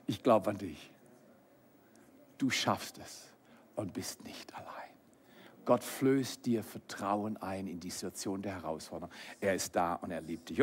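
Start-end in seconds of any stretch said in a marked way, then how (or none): 0.1-4.7 soft, low self-encouragement; tender reassurance. Acted. Speaking to yourself in encouragement, tender and hushed. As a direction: let it fade almost to a whisper.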